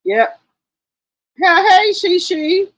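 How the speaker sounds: normal talking voice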